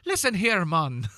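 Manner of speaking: high, loud mock Jamaican accent. Mock Jamaican impression, friends joking.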